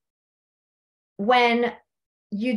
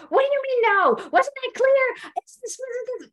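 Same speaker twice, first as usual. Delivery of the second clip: imitating a screaming husband; 13 semitones higher than usual. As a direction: yell it in disbelief at her. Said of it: "continues to scream".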